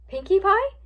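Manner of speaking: high, loud rising question; gasped surprise. A narrator's reading. Twilight learning whom they will see, gasped surprise. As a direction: ask it in astonishment. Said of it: "Twilight gasped".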